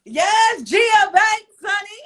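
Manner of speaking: high, loud, delighted confirmation; excited exclamation. Real, not acted. Host hyping a friend's name to the guest, excited. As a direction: shout the name with real relish.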